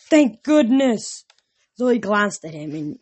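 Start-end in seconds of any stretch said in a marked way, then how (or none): 0.1-1.2 relieved satyr. Acted. Grover relieved at Percy.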